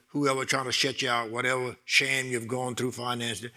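normal talking voice

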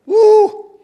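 sudden high loud woo; joyful whoop. Real, not acted. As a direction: let out a happy whoop. Preacher whoops at powerful verse.

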